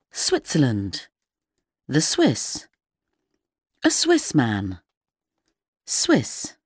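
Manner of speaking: each word said on its own with a falling close; vocabulary list reading. Acted. Teacher reading nations and nationalities to learners, slow and careful.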